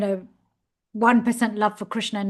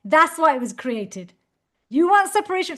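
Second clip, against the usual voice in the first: higher, louder stern teacher's reply; heavy rebuke; 6 semitones higher, 4 dB louder than usual. Retold or imitated. Teacher firmly correcting his questioning student, loud and stern. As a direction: land the verdict hard on you did. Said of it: "He said".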